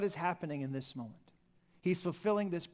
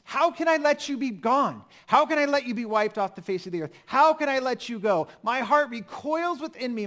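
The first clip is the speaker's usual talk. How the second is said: higher and louder, a desperate cry of love; desperate plea; 5 semitones higher, 6 dB louder than usual. Acted. Preacher voicing God's anguished plea to Israel, desperate and loud. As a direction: plead as if you cannot bear to let them go. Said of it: "Hear the desperation in this".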